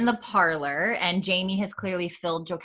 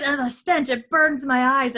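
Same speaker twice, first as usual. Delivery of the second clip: higher, louder, slow wail about the stench; hammed-up suffering lad; 7 semitones higher, 5 dB louder than usual. Boy complaining of the skunk smell, hammed-up wail. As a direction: ham up the burning eyes. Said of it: "he says".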